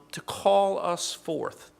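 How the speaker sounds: normal talking voice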